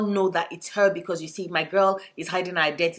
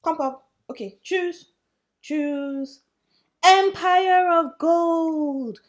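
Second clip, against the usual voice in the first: high coaxing voice; 9 semitones higher, 3 dB louder, much slower than usual. Real, not acted. Talking to cat, revealing pick.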